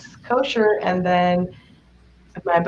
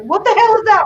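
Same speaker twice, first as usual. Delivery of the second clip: high, louder horrified squeal; alarmed shriek; 5 dB louder than usual. Retold or imitated. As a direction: imitate a friend shrieking at the video. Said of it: "She's like".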